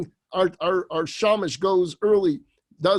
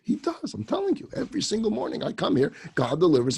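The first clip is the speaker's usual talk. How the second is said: eager insisting; 2 semitones higher than usual. Acted. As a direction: insist like an innocent simpleton. Simpleton insists to rabbi.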